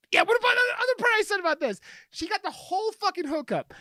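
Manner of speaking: high, fast indignant protest; worked-up retort. Retold or imitated. Acts out complaint to Andy.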